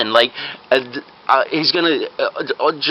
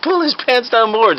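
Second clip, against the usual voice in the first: high, drawn-out order; commanding fake doctor; 9 semitones higher, slower than usual. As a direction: give the order with mock urgency.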